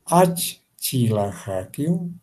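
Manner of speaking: Achilajakiu drawn out slowly, syllables separated; slow emphasized articulation. Shown as a demonstration. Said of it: "enfatizar en las palabras que dificultan". (Achilajakiu despacio, sílaba por sílaba)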